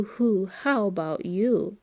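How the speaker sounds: ordinary delivery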